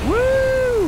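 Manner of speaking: high, loud cheer; thrilled whoop. Real, not acted. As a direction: let out a delighted whoop. Doing tricks on four-wheeler.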